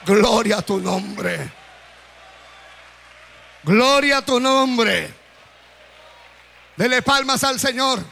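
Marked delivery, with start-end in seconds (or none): 0.0-5.3 Paul's words preached. Retold or imitated. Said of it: "el apóstol Pablo dice".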